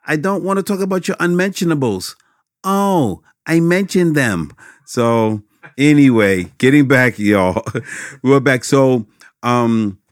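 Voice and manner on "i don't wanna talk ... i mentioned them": Steve Urkel impression. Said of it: "how does Steve Urkel say".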